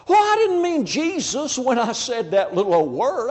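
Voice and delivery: high-pitched put-on denial; hammed-up plea of innocence; mock indignant protest.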